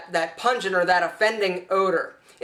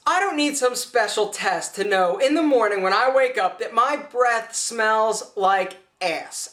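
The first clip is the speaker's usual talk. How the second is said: normal talking voice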